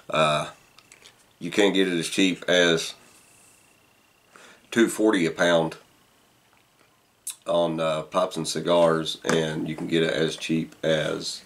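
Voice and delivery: talking around pipe stem; pipe in mouth. Real, not acted. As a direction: lips clenched on pipe. Seen 4: Pipe stem clenched in mouth during most frames; briefly lowered ~3-5s.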